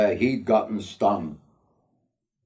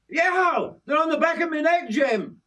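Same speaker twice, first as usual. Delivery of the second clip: very high-pitched, frantic yelping; panicked yelp. A narrator's reading. Yorkie being stung on the neck by bees, panicked yelp. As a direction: yelp in pain, pitched right up.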